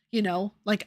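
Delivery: ordinary delivery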